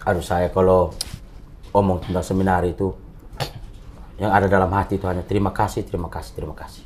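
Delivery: low, soft, heartfelt thanks; sincere, from the heart. Real, not acted. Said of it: "benar-benar serius dari hati".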